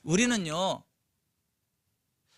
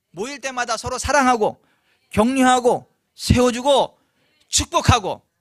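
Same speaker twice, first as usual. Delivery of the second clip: loud, high, impassioned sermon delivery; fervent preaching; 6 semitones higher, 11 dB louder than usual. Acted. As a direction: preach it out with full force. Preaching at full force.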